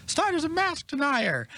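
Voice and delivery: high, drawn-out mocking accusation; slow, squeaky parody of an outraged accuser. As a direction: imitate a whiny critic way up high. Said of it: "someone would be like".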